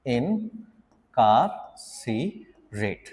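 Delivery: इनकार्सिरेट drawn out at a crawl; very slow. Shown as a demonstration. Teacher models the word very slowly for students. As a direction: stretch इनकार्सिरेट long and low. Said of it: "word है बेटा".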